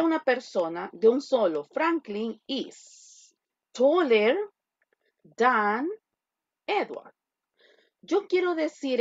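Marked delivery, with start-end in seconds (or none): 1.6-7.1 slow, drawn-out model sentence; slow dictation. Shown as a demonstration. Teacher repeating the model sentence slowly. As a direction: read it out slowly for students. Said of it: "teacher modeling sentence".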